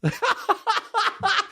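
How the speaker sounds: high-pitched cackling burst; real laugh. Real, not acted. Comic laughing at his New York buddies, high cackle. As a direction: crack up in a rapid, high giggle. Cracks up at his own impression.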